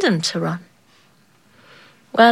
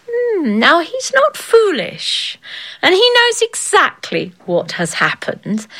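higher, slow knowing hum; a knowing king, thinking it over slowly; lightly voiced shrewd king; musing king; 8 semitones higher than usual. A narrator's reading.

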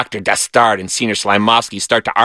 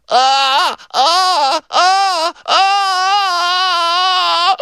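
theatrical high-pitched moaning; high drawn-out moan; much slower than usual. A narrator's reading. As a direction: moan in rising, long wails. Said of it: "Philip moaned".

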